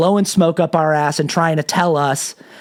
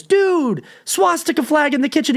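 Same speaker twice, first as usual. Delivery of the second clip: much higher, exasperated protest; shocked friend; 10 semitones higher than usual. Retold or imitated. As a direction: call it out in disbelief. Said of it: "And he goes".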